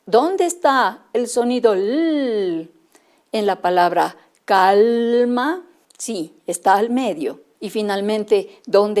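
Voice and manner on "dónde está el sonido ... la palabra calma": slow, word by word, with libro stretched; slow teaching voice. Shown as a demonstration. Teacher asking children where the sound l is, slowly. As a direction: spell it out slowly for little ones. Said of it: "si yo digo".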